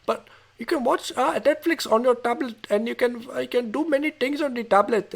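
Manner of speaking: imitating a caller's Indian accent, high-voiced. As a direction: put on an Indian call-centre accent. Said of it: "I'm doing an Indian accent".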